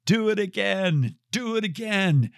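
high-pitched cheer at no real volume; mock crowd yell. Crowd below calls to the tightrope walker, mock yell. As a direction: imitate a chanting crowd in a high, held-back shout. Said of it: "suddenly they start to yell".

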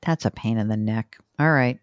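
normal talking voice

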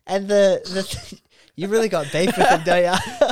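laughing loud tease. Real, not acted. Laughing, teasing friend.

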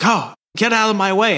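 high, snooty prince voice; haughty prince. Retold or imitated. Prince shoving past the guards, high and haughty. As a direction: brush them aside like royalty. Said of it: "he's like".

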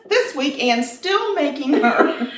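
high, giggling through the words; can barely get it out for laughing; real laughter, slowing at the end. Real, not acted. Laughing about Anne's cake.

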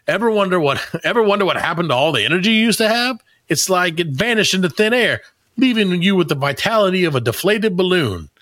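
high-pitched, lively ad announcer read; attempted pitchman voice. Reading AI-written sponsor copy to co-hosts, high announcer voice. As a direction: sell it big, voice lifted high. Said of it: "I'm trying to do".

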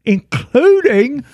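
hammed-up Essex accent. Reading Chris from Essex's email aloud, put-on Essex accent. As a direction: read it out like an Essex bloke. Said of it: "Can you do that accent".

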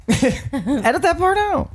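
squeaky voice. Acted. Squeaky mock plea.

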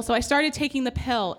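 normal talking voice

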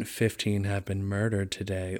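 low, quiet sombre anchor; grim newscaster. Retold or imitated. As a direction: drop into sad news. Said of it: "the shift".

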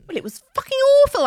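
high, loud and fast exclaimed; real outburst about a tearjerker; exasperated outburst. Real, not acted. Venting about the sad film.

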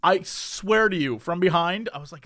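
ordinary talk, nothing marked

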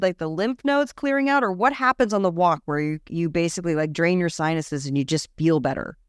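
ordinary talk, nothing marked

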